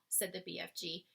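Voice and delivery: usual speaking voice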